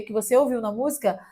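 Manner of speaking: ordinary delivery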